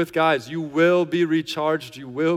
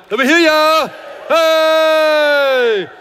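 loud rallying shout, much higher; 11 semitones higher, 9 dB louder, much slower than usual. Real, not acted. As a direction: whip the congregation into a big shout. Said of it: "why not trust him and shout".

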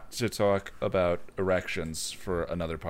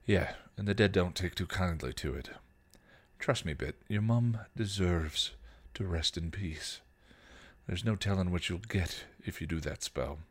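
story narration; 3 semitones lower, 4 dB quieter than usual. A narrator's reading. Reads fan fiction aloud.

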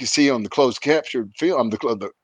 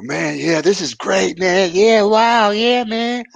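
high, dazed stoner voices; imitating tripping guys; 8 semitones higher, much slower than usual. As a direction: drift up into a blissed-out high. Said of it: "so they're like".

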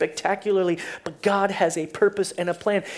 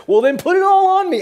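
high, loud comic over-eagerness; imitating an excitable zealot, pitch way up; over-eager Peter; 12 semitones higher, 12 dB louder than usual. Preacher acting Peter demanding a full washing, over-eager. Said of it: "he's just this crazy guy".

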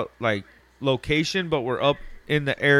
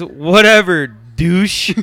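dismissive; 3 semitones higher, 8 dB louder, much slower than usual. Retold or imitated. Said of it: "He says".

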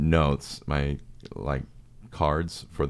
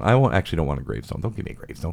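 ordinary talk, nothing marked

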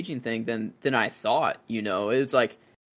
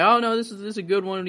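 salesman voice; 7 semitones higher, faster than usual. Retold or imitated. Casket dealer voiced in retelling.

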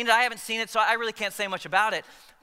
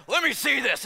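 eager push; 7 semitones higher, 5 dB louder than usual. Retold or imitated. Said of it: "he's like".

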